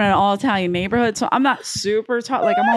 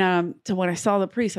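ordinary delivery

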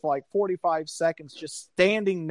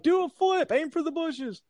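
much higher teasing cartoonish heckle; mocking heckler; 11 semitones higher than usual. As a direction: goad them like a cruel onlooker. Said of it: "You're like".

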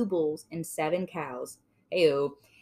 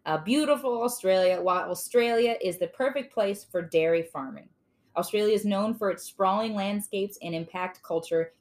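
ordinary delivery

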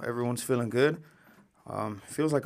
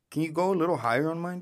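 ordinary talk

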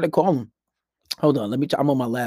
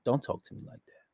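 hushed, unbothered boundary; nearly whispered, calm refusal to engage; 3 semitones lower, 12 dB quieter than usual. Retold or imitated. Randy to an upset girl, calm and hushed. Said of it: "He'll be calm".

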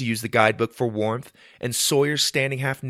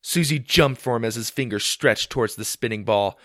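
plain reading voice

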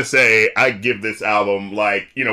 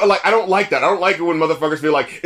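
usual speaking voice

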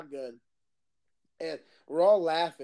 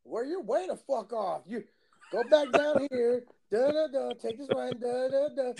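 slow, higher-pitched mock local; landowner giving directions; 6 semitones higher than usual. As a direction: act out a local slowly rattling off directions.

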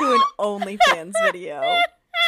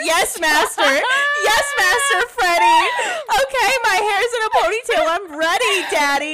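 mock eager submissive; 8 semitones higher, 4 dB louder than usual. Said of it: "I was like".

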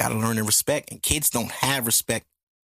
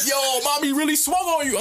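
teasing brother; 12 semitones higher than usual. Retold or imitated. Voicing laughing brother.

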